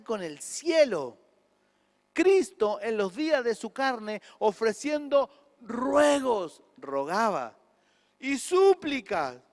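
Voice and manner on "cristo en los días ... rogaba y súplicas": slow, loud, rising preacher's cry; fervent pulpit reading of scripture; full-force preaching. Acted. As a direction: drag out ruegos and súplicas like a plea.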